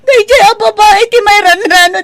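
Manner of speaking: high, loud, tearful rant at the father; furious crying rant; angry tearful outburst. Acted. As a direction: lash out, voice near tears. Daughter's tearful rant continues.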